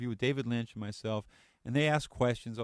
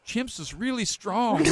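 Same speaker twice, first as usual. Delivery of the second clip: slowly, laughing through the words, higher and a little louder; laughing quote; 10 semitones higher, 4 dB louder, slower than usual. Retold or imitated. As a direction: let the laugh break each word slowly. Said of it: "he goes".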